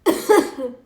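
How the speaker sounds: loud showing cough; one sharp loud cough for the camera; cough demo. Shown as a demonstration. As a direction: cough to show it's clear. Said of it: "That's my normal cough".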